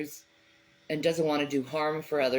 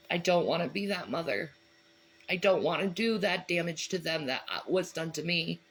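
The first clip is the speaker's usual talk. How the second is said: ordinary talk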